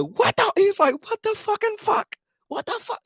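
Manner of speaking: high mock yelling, pitch way up. Retelling Dalton yelling at linemen, high-pitched mock yell. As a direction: squeal it out like a furious teammate. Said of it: "Dalton was yelling at him 'cause he like".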